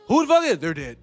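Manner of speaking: ordinary talk, nothing marked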